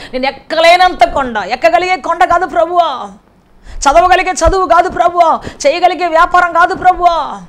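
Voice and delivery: high, loud, impassioned prayer cry; preaching at full force. Acted. Preacher cries out David's prayer to the Lord, high and loud. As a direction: plead with God at the top of your voice.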